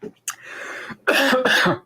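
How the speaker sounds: harsh choking cough; coughing up water. Real, not acted. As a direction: cough hard, water down the wrong pipe. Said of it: "I went down the wrong pipe".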